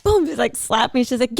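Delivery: ordinary talk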